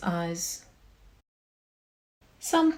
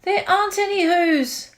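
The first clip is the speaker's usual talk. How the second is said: louder, higher jeering shout; mocking shout; 6 semitones higher, 5 dB louder than usual. A narrator's reading. Wickersham brothers shouting at Horton, loud jeers. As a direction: shout the elephant down. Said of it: "came shouting".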